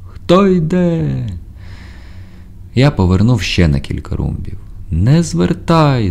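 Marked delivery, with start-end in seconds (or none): 0.1-1.3 very high, long-drawn calling voice; drawn-out cry. A narrator's reading. Stranger hailing again through fog, high drawn-out cry. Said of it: "знову крикнув". 4.9-6.1 high, slow calling command; drawn-out cry. A narrator's reading. Voice in the fog warning the sailor, high drawn-out cry. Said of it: "знову закричав голос".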